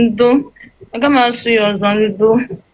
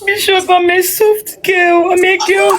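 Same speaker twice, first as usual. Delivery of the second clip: put-on 1 high pleading girl; 9 semitones higher, slower than usual.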